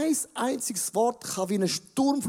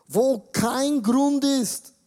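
dramatic slow reading; 5 semitones higher, 3 dB louder, much slower than usual. Acted. Preacher reads David's psalm dramatically.

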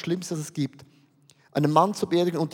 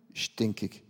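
low, much softer, glum and bored; 11 semitones lower, 9 dB quieter than usual. Pretend. Preacher voices how listeners feel about everyday life, glum and soft. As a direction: sink the word like a weary sigh. Congregation's dull everyday named.

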